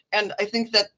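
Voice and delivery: ordinary delivery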